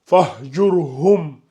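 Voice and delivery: slow Fahjurhum with a full, heavy r; recited emphatic ra. Shown as a demonstration. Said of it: "il est emphatique".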